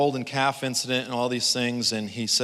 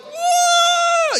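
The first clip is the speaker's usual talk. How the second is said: very high, loud scream of wah; mock frightened scream; 8 dB louder than usual. People startled by God, high scream. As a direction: shriek like you jumped in fright. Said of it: "And we'd be like".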